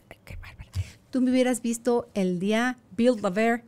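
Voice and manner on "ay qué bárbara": whispered mock tut of disapproval; a whispered "ay, qué bárbara" in mock disapproval; mock scolding. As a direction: breathe it like a shocked prude. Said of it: "la creencia de".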